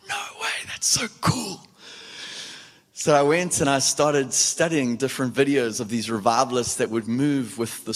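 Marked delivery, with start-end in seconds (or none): 0.1-1.7 high whispered wonder; awed whisper. Retold or imitated. Recalling his amazed thought in a whisper.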